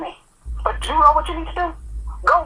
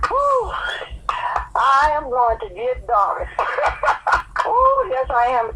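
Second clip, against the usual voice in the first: slow words through real laughter; amused mock threat while laughing; slower than usual. Real, not acted. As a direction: laugh it out, caught by the prank. Realizes it was a prank.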